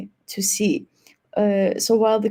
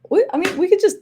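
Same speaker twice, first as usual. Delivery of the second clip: higher, louder, fast laughing speech; 5 semitones higher, 7 dB louder than usual. Real, not acted. Moderator offering co-host a break, laughing. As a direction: let real laughter break through the words.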